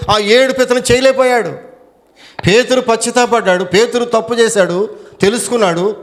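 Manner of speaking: impassioned sermon cry; fervent preaching. Acted. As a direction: drive the point home from the pulpit. Sermon rising to full force.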